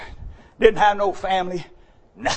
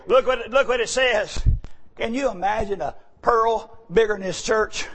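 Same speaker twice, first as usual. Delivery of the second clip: ordinary delivery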